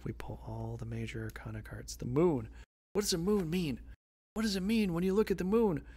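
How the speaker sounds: usual speaking voice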